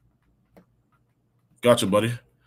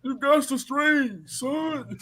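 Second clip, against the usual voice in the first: much higher, slow hammed-up coach voice; imitating Coach Odell; 10 semitones higher, much slower than usual. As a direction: stretch it out high like a coach urging his player on. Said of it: "That's exactly how he sounds".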